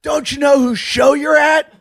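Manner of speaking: loud, high, slow imitation of a fan; mock indignant shout. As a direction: shout it like an outraged heckler. Heckler's retort acted out in quarrel.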